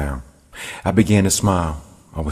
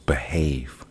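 a whispered warning; whispering; 3 semitones lower, 6 dB quieter than usual. Acted. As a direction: whisper it, hushed and stern. Hushed warning to the devil.

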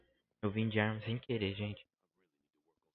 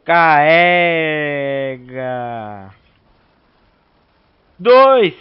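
long loud drawn-out yell; shouting; 4 semitones higher, 18 dB louder, much slower than usual. Real, not acted. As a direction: belt it out urgently. Urgent shouts in game fight.

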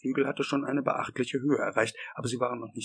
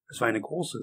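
ordinary delivery, nothing marked

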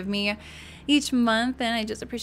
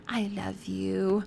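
slow, soft imitation of a sweet little kid; lightly voiced child saying it tenderly; drawn-out, gentle mimicking of a child; small son's declaration; 6 dB quieter, much slower than usual. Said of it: "he'll just come up to me".

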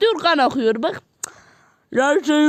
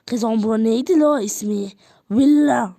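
normal talking voice

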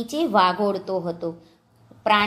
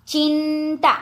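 ચિંતા high, first syllable held; slow word demonstration; 5 semitones higher, slower than usual. Shown as a demonstration. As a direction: hold the nasal sound in ચિંતા. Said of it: "જોડણી ઉપર જોજો".

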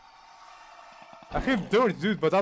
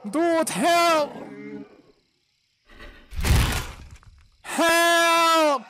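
high, loud desperate calls; shouting for help; 10 semitones higher, 3 dB louder, much slower than usual. Real, not acted. Hunted player yelling to teammates for help. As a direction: call out for rescue at full voice.

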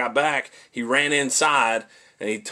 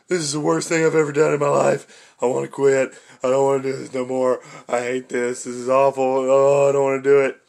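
mildly whiny, faster inner moaning; whiny complaining; 3 dB louder, faster than usual. Retold or imitated. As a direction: grumble like you want to quit. Miserable inner voice running alone.

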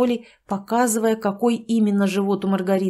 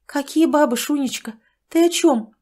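higher, bewildered question; puzzled; 7 semitones higher, 4 dB louder than usual. Acted. Tatyana asks Sanych, higher and bewildered. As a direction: sound completely baffled. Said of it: "не поняла Татьяна Ивановна".

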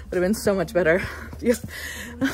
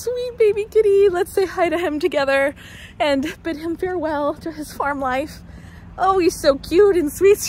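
cooing at a cat; 9 semitones higher than usual. Real, not acted. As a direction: coo up high like greeting a kitten.

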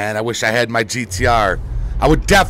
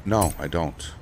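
usual speaking voice

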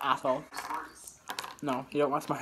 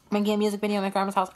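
fast low voice; 8 semitones lower, 5 dB quieter, much faster than usual. Pretend. Mock quirky low voice.

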